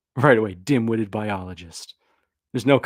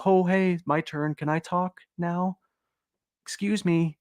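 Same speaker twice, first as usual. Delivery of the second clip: higher, slow, timid asking for a turn; hammed-up timid plea to speak; meek polite voice; 6 semitones higher than usual. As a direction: play the overlooked idealist raising a hand. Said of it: "We've been polite for a hundred years".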